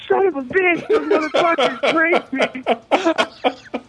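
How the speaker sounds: disbelieving outrage at the mirror; outraged shock. Retold or imitated. He finds marker makeup on his face, shocked outrage.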